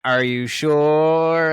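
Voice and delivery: loud, very slow put-on coaxing voice; a hammed-up loud drawn-out coax; imitating the woman. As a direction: drag it out, pressing him. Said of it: "and then she goes".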